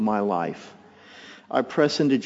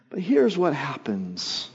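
normal talking voice

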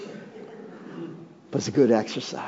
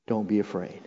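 soft reassurance; 4 semitones lower, 6 dB quieter, faster than usual. Shown as a demonstration. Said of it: "Say it with me".

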